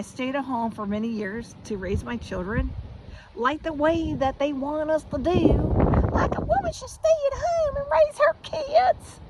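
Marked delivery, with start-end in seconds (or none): 3.4-9.2 loud, very high mock prim voice. Woman mocking society's rules for mothers, high mocking voice. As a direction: squeal it loud in scornful mimicry. Mocking what "they" expect of women.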